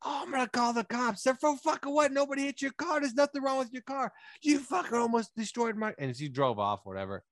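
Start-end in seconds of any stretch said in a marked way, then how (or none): 0.0-5.9 high, shrill-pitched mock yelling; hammed-up road argument, pitched way up; heated argument. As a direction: hurl it back in a high mock rant. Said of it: "And then I was like".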